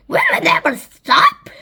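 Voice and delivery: excited shouted question. A narrator's reading. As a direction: call it out in amazement. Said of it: "And Sponge shouted".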